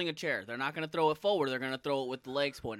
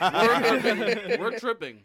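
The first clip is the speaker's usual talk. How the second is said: laughing loud and high; 8 semitones higher, 9 dB louder, much slower than usual. Real, not acted. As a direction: laugh through the words. Laughing exclaim.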